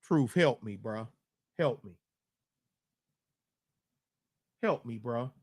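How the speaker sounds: soft mock plea for help; pleading. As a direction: beg quietly, half joking. Begging cohost for help.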